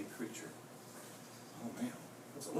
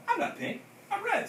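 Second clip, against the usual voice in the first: put-on much higher kid voice; child's voice; 14 semitones higher than usual. A narrator's reading. Skippy correcting Mama, high small-child voice. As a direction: pipe up like a little kid. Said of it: "said Skippy".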